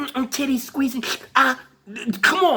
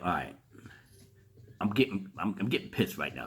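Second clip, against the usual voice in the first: low, much softer inner voice; muttering to himself; 12 semitones lower, 13 dB quieter than usual. Retold or imitated. Retelling his own thoughts, muttered low and soft. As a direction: grumble it under your breath, lower and softer. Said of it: "And I'm like".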